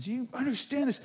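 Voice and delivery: high, loud and urgent retold rant; fired up. Joe to his pastor about hungry kids, fired up and urgent. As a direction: plead hard for the hungry kids. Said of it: "He's just all fired up".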